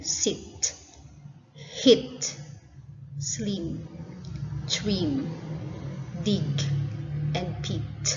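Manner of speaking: word list with short "i" as in "sit"; each of "hit, slim, dig" said separately with short I. Shown as a demonstration. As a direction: isolate each word, short vowel as in "pit". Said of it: "Short I".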